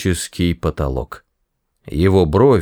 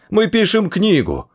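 much higher, desperate plea; pleading; 11 semitones higher than usual. A narrator's reading. Ford begging the Vogon for mercy, high and pleading. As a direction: plead your case in a high voice. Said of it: "взмолился Форд".

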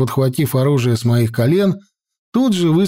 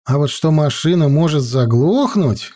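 ordinary delivery

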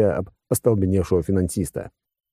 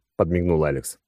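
ordinary delivery, nothing marked

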